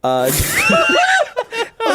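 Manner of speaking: high, drawn-out laughing fit; cracking up. Real, not acted. Cracks up retelling waiter's name.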